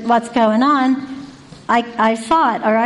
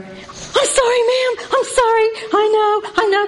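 sheepish apology; 11 semitones higher than usual. Retold or imitated. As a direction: plead like a boy caught red-handed. Matt caught, apologizing in high voice.

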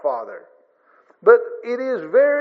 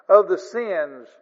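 ordinary talk

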